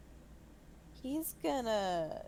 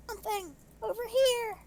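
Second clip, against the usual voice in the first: loud stage whisper, much higher; 13 semitones higher, 6 dB louder than usual. Acted. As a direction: whisper it loud enough for all. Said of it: "Obviously, that was a stage whisper".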